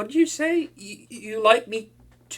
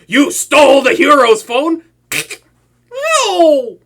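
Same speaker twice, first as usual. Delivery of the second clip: loud, the No much higher; outraged disbelief; 5 semitones higher, 12 dB louder, slower than usual. Acted. Villain accusing his hostage of theft, loud shocked outburst. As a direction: shout the accusation then refuse it.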